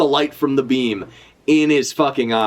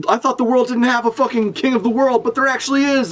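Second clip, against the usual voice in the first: retold scream in a raised, strained pitch; mock scream; 9 semitones higher than usual. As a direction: imitate someone yelling urgent news, voice pushed high. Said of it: "screaming at the top of his lungs".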